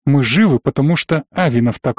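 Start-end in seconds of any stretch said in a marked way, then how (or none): none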